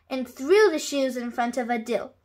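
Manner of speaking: usual speaking voice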